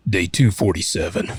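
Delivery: deep, dramatic trailer narrator; movie trailer voice. Acted. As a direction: announce it like an epic film trailer. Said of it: "do it in your movie voice".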